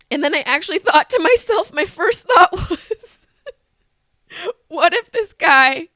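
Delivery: helpless laughter through the words; real laughing. Real, not acted. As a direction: crack up while telling it. Cracks up telling his thought.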